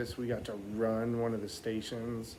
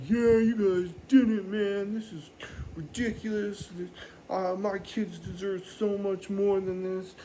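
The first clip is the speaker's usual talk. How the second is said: high, strained mock yell; yelling dad; 6 semitones higher than usual. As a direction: imitate an indignant dad in a raised pitch. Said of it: "yelling at us".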